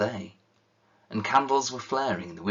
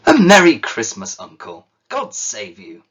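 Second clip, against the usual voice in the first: cheerful cry; 2 semitones higher, 15 dB louder than usual. Acted. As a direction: call out a merry greeting at the door. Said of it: "cried a cheerful voice".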